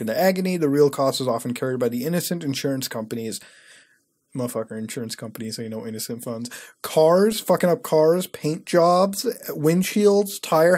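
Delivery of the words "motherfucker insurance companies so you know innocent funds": sarcastic mutter. Real, not acted.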